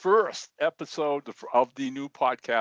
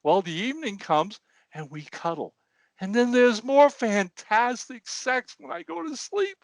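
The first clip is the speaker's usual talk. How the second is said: high mock-weepy old man; hammed-up tearful old man; crying old man; 12 semitones higher than usual. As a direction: wail the last part, pitched high. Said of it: "He goes".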